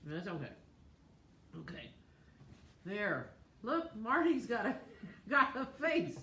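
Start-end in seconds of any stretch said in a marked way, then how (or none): 3.6-6.2 giggling through the words; laughing. Real, not acted. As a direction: laugh while you say it. Laughing at Marty's face.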